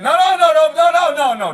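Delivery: loud protest. Real, not acted. Friend protests the drink.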